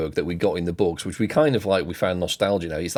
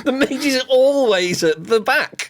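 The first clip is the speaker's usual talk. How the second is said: amused insistence. Real, not acted. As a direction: state the obvious with a laugh. Laughing agreement about the mage.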